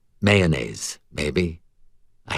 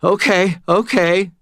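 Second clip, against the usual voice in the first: frightened; 10 semitones higher, 4 dB louder than usual. A narrator's reading. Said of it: "Stan said".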